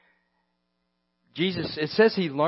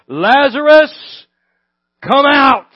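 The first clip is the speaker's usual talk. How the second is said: Lazarus shouted much louder and higher; loud call; 7 semitones higher, 12 dB louder, slower than usual. Acted. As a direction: call him out of the tomb. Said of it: "Jesus called in a loud voice".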